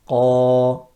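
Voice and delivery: loud, heavy Qa. Shown as a demonstration. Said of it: "the sound going upwards".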